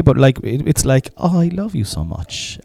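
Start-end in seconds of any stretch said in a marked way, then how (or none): 1.2-2.6 higher, slow, sickly-sweet mom; mock loving mother. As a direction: gush it like a doting mother. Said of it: "kinda saying it from a loving way".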